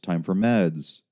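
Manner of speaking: plain reading voice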